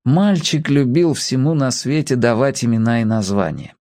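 usual speaking voice

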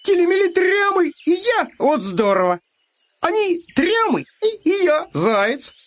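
very high, squealing hare delight; joyful shouting. A narrator's reading. Hare thinks it's his birthday surprise, high joyful cries. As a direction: burst out thrilled, pitch sky-high. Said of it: "вдруг радостно закричал".

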